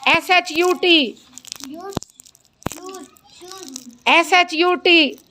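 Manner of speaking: एस एच यू टी spelled slowly, stress on एच; teacher spelling shut for the class. Shown as a demonstration. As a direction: drag each letter, hit एच. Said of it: "शीन की आवाज निकलेगी".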